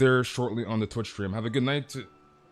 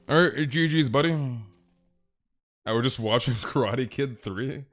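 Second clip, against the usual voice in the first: normal talking voice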